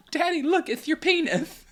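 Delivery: put-on high toddler voice with slushy lisp; high lisping excited toddler. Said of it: "He has a lateral, lateral lisp".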